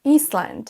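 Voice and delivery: Iceland as EES-land, misreading island with an S; pronounced S. Shown as a demonstration. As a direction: sound the silent S, Iceland as iss-land. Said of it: "envie de prononcer le S". (Iceland avec le S prononcé, iss-land)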